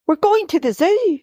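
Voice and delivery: a touch of a high, delighted squeal; lightly voiced mum, thrilled; mild delighted shriek. A narrator's reading. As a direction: announce the surprise with glee. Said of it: "she shrieks with delight".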